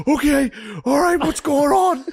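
high flustered panic. Retold or imitated. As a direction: babble it in alarm. Drunk dad flustered.

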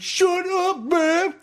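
high-pitched mock teenage yell; yelling from upstairs. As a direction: yell it down the stairs at mom, hammed-up. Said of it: "I was at the top of his steps".

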